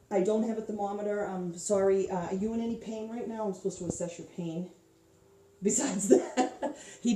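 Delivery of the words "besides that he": laughing. Real, not acted. Laughs mid assessment.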